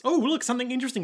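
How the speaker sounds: quick tongue-in-cheek gasp; fast mock aside. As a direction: blurt it quickly, faux excited. Mocking Graham's talk.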